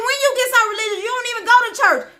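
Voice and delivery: very high, quick teasing voice; mock jeer pitched far up; mocking officers. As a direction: imitate incredulous colleagues needling him. Other officers mocking him, retold.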